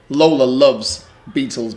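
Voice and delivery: ordinary delivery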